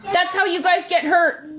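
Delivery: scolding shout. Real, not acted. Mom yelling at kids in pantry.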